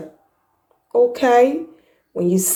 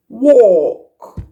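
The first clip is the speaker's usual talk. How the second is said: ordinary delivery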